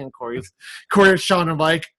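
usual speaking voice

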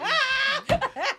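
high-pitched burst of laughter; real laugh. Real, not acted. Cracking up at a friend's joke, real high laugh.

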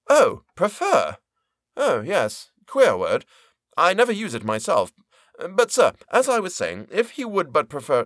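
mock-innocent clerk, lightly voiced; voiced Turkey. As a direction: play innocent, he never uses the word. Turkey voiced, surprised then resuming.

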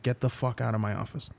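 low, soft retold order; very quiet. Lawyer repeating his order to the agent, very quietly. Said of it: "very quietly, I said".

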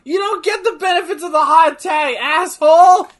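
loud, high, outraged heckling; indignant shouting. Real, not acted. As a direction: yell it at the wrestler in exasperation. Yelling at wrestler on screen.

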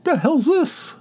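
very high, squealed disbelief; incredulous squeal. Retold or imitated. Telling about the neighbor's car, squealing in disbelief. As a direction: squeak out the shock. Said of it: "I'm like".

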